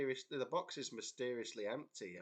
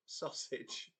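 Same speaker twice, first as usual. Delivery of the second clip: half-asleep blurt; 3 semitones higher than usual. Retold or imitated. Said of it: "I just said the word".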